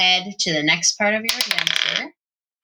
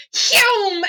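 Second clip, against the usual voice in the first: sled whoosh; 11 semitones higher than usual. Acted. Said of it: "you see the sled go".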